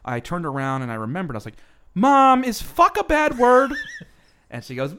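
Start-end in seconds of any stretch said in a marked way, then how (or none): 2.0-3.7 put-on high, loud, slow little-boy voice; innocent kid. [name] as a first grader asking his mom about a word, high and slow. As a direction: ask it wide-eyed and slow.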